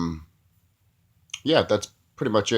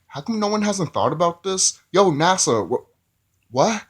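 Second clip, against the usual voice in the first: baffled; 7 semitones higher than usual. Retold or imitated. Baffled reaction to plane scene.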